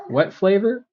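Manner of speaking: high rising puzzled query; confused question. Retold or imitated. Pharmacy clerk to customer about the flavor, mock-confused question. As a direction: imitate a baffled clerk asking back.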